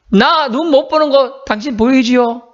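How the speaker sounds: high, slow plea of a blind man; acted pleading. 맹인의 간청을 연기함. (높고 느리게 간청하듯)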